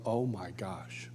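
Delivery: soft, slow, breathless oh my gosh; hushed awe. Retold or imitated. Seeing Jesus descend over the circle, hushed awe. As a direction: let the awe drain your voice to a hush. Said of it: "and I go".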